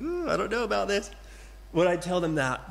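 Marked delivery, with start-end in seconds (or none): 0.0-1.1 higher, quick mock worry; a fretful inward ooh; inner doubt. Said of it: "inside I'm thinking".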